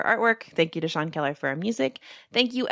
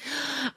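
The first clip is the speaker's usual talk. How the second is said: sharp mock gasp; 7 semitones higher, 7 dB quieter than usual. Reacts to news of no cars.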